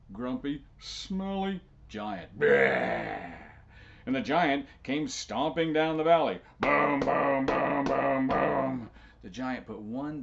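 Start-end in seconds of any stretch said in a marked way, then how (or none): 0.8-3.3 a low, loud bleh of disgust. A narrator's reading. Storyteller groaning at the smelly giant, loud and low. 6.6-8.9 loud heavy stomping booms. A narrator's reading. Storyteller stomping the giant down the valley, loud booms.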